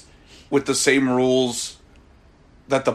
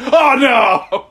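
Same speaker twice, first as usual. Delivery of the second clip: mock dramatic cry; 8 semitones higher, 4 dB louder than usual. Imhotep runs in.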